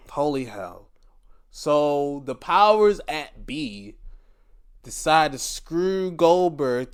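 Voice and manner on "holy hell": "holy hell" breathed out in a soft whisper after a sigh; exasperated whisper of "holy hell". Real, not acted.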